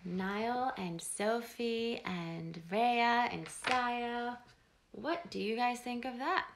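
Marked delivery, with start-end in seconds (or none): 0.0-4.4 drawn-out names. Real, not acted. Calling the children's names.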